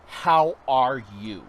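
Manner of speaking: loud, slow, drawn-out how are you; "How" stretched slow and loud as a big friendly hello; bright greeting. Retold or imitated. Asking a stranger "How" loud and slow, friendly. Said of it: "I'm like, "No".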